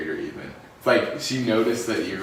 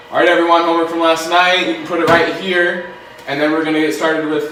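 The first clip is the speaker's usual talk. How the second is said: higher, louder classroom announcement; loud teacher voice; 8 semitones higher, 10 dB louder than usual. Retold or imitated. Teacher addressing the class to hand in homework, loud and bright. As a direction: call the class to order like a teacher.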